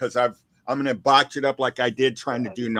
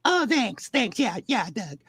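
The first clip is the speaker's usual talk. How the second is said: put-on high voice with mock French; Becky impression; 7 semitones higher than usual. Guest imitates Becky thanking the host, high falsetto. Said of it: "Let's hear the Becky accent".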